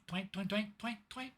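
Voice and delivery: twanging strings. Acted. GM voicing burning crossbow strings snapping in turn, twangs climbing in pitch. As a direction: pluck each twang up the scale.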